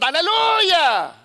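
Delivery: high, loud, drawn-out cry of joy; joyful hallelujah shout. Acted. Woman who finds her lost coin, shouting overjoyed. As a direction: belt it out in sheer triumph. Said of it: "when she finds the coin, she said".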